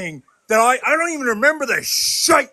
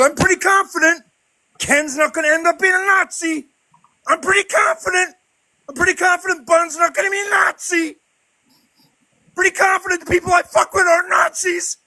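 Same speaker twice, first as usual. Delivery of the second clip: sarcastic shout; 6 semitones higher than usual. Real, not acted. Sarcastic furious rant.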